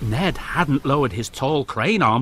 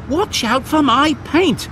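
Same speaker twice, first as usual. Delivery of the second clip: slow, higher sharp warning; snapping car; 9 semitones higher than usual. A narrator's reading. Car Isabella snaps at Jack, sharp and higher. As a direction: snap at the clumsy loader. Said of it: "snapped a car".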